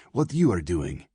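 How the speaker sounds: "you" stressed to turn it back; emphatic "you", firmly contrasted. A narrator's reading. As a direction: lean on "you" as a correction. Girl turns his question back.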